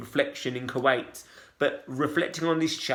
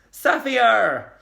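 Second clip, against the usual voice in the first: higher, louder, lifting the name; bright greeting; 8 semitones higher, 6 dB louder than usual. A narrator's reading. Cheerful nurse greets Safia, high and bright. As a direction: greet her like a happy surprise.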